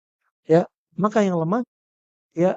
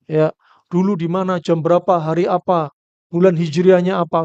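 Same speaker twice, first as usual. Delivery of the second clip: rising mock listing; 8 dB louder than usual. Mocking a wife listing grievances.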